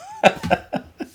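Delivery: high breathy laugh. Real, not acted. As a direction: burst out laughing. Laughing at own story.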